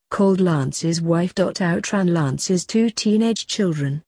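plain reading voice

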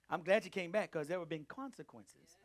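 ordinary talk, nothing marked

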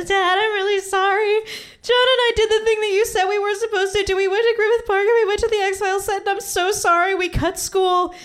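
high-pitched, wailing sobbed apology, acted out; tearful confession. Daughter confessing to her dad after bursting into tears, tearful high wail. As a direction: blurt it out in a high mock-crying child's wail. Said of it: "I burst into tears".

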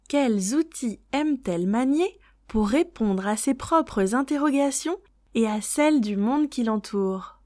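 ordinary delivery

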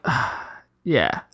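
soft, low sheepish admission. Retold or imitated. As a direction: mumble it, caught out. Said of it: "And I'm like".

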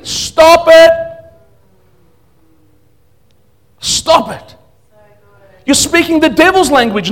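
loud, high fiery sermon; fiery preaching. Acted. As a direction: preach it with full force. Preaching at full force.

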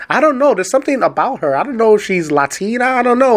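high, puzzled musing of an onlooker; puzzled stranger. Retold or imitated. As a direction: play someone unsure where she's from. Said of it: "they're like".

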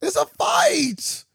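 loud, slow, drawn-out shout. Real, not acted. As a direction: yell it out slowly. Repeats it, now shouting.

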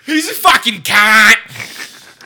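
slow, loud, high Aussie insult; Australian accent. Acted. Local cursing Jimmy from afar, shouted Australian accent. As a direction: stretch it into a hammed-up Australian yell. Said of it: "I love doing my Australian accent".

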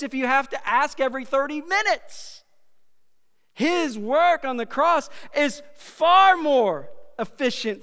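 high, loud, driving sermon climax; full-force preaching. Real, not acted. Preacher pressing the congregation on God's lavish forgiveness, booming and high. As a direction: preach it out with everything.

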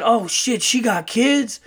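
high-pitched brash uncle, mock-alarmed; Earl. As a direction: imitate a brash guy's horrified punchline. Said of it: "like, a very brash uncle".

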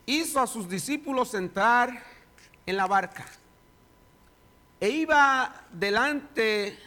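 dramatic bible reading, rising in swells; scripture reading. Acted. Pastor reading Matthew to the church, dramatic scripture reading. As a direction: read the gospel to the congregation with drama.

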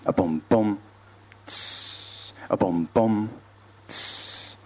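soft vocal kick drum and hissed cymbal; beatbox low and let it fade. Acted. God grabs the mic, beatboxing to a fade-out. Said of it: "beatboxes to a fade out".

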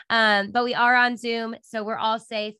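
usual speaking voice

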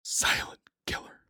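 hushed, ominous whisper; spooky whisper. Acted. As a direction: whisper it like a horror trailer. Dramatic whispered aside.

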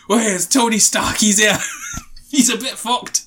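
badly drifting Scottish accent, hammed up; slipped Scottish accent. Riffing on Doolittle among the Avengers, accidental Scottish. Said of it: "I don't know why I would scotch this".

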